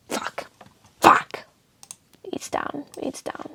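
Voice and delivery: soft unvoiced mutter; whispering. Real, not acted. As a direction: murmur it to yourself. Muttered verdict.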